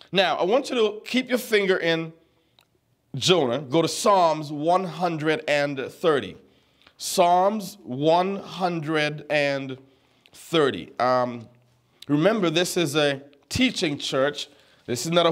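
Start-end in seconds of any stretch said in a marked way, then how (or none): 3.6-11.0 slow announcement. Shown as a demonstration. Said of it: "pastor gives the reference slowly".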